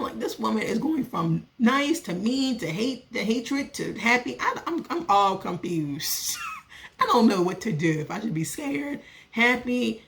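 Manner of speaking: ordinary talk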